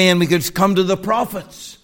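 usual speaking voice

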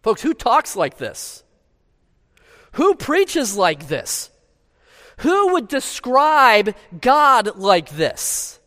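high, slow, ringing rhetorical questions; impassioned preaching. Real, not acted. Preacher asking who would describe God so, impassioned preaching. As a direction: challenge the congregation, stretching each question out.

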